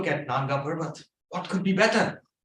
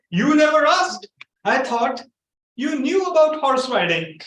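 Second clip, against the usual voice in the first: much higher, louder indignant retort; indignant shout; 10 semitones higher, 7 dB louder than usual. Retold or imitated. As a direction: fire back, high and loud, offended. Groom's indignant reply.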